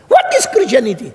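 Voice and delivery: high, loud, scornful outburst; fierce woman shouting. Retold or imitated. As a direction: imitate a fierce heckler yelling in rejection. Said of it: "dia teriak-teriak perempuan galak seperti itu".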